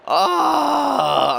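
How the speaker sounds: high cry of dismay; anguished cry. Real, not acted. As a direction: wail it out. Dramatic count reaction.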